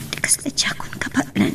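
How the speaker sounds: whisper. Acted. A worker alone in her room, whispering. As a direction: breathe the words so no one hears.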